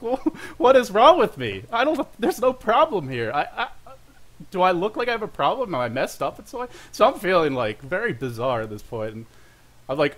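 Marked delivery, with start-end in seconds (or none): 0.0-6.7 indignant bewilderment. Retold or imitated. Answering the gym clerk, puzzled and indignant. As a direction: play it baffled, as if accused of being depressed.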